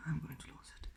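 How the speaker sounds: scared whisper. Real, not acted. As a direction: whisper it, afraid of being heard. Scared in dark basement.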